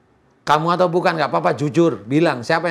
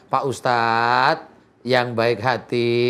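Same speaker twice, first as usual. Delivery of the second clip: slow, measured, lower plea; coaxing model line; 4 semitones lower, much slower than usual. Shown as a demonstration. Exorcist teaching the jinn how to plead, slow coaxing. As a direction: spell out the polite request slowly. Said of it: "Bilang sama saya".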